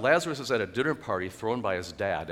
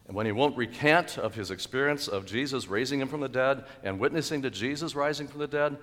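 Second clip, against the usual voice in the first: normal talking voice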